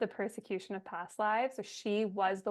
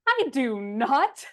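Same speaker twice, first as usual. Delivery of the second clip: mock dad denial; 4 semitones higher, 6 dB louder, much slower than usual. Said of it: "My dad goes".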